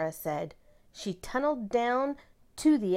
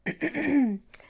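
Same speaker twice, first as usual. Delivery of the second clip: a pointed, attention-getting throat clear; 7 semitones higher, 5 dB louder than usual. A narrator's reading. Principal quiets the class, clearing her throat. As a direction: clear your throat to hush the room. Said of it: "Missus Burton cleared her throat".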